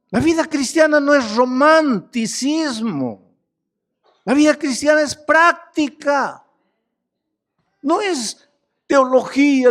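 fervent pulpit preaching; fiery preaching. Acted. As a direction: preach it out to the congregation. Preacher at full force.

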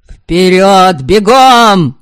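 loud battle cry, shouting the charge; yelling. Acted. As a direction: bellow the order to charge. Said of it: "орал Верем".

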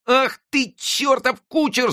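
very high furious cry of pain; angry boy's yell. A narrator's reading. Friquet whipped by the coachman, very high furious yell. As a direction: curse the coachman who whipped you. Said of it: "закричал он".

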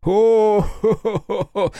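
high slow booming ho-ho laugh; hearty laugh. Acted. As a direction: laugh loud, slapping thighs. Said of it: "Аяшики расхохотался". (раскатистый хохот)

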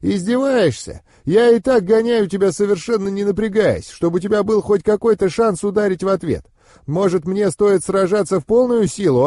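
high-pitched, indignant scolding; mentor's irritated, mocking tirade; angry mentor. A narrator's reading. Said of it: "гневно прорычал наставник".

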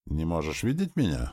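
ordinary delivery, nothing marked

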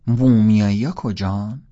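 slow, loud mock question; teasing repeat. A narrator's reading. Holden teasing the boys about the mummies, slow and loud. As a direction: drag it out loudly to tease the kid. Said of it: "از یکیشون پرسیدم".

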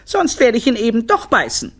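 put-on high little-kid threat; high, cheeky child's voice; defiant youngest child. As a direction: pipe up stubbornly like a small child. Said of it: "der Kleinste fügte hinzu".

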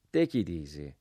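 usual speaking voice

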